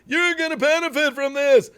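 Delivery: high-pitched imitation of an upbeat promoter; mock positive sales pitch. Streamer imitating someone selling it as all positive, mock upbeat pitch. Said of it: "something's being sold as all positive".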